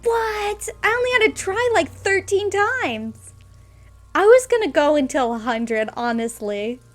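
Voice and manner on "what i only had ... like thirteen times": high, loud squeal of amazed delight; overjoyed gasp, then drawn-out laughing brag; thrilled disbelief. Real, not acted. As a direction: shriek like you just won the jackpot. Roll finally succeeds after tries.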